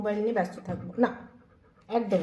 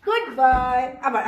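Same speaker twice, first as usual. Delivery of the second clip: loud praise; 7 dB louder, slower than usual. Real, not acted. Praising dog after high five.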